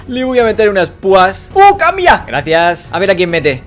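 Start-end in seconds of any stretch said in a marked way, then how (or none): none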